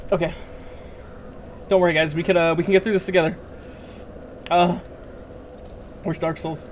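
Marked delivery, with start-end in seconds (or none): 2.2-6.7 talking through a burning mouth; mouth on fire. Real, not acted. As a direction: speak with a scorched tongue. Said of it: "my mouth is on fire".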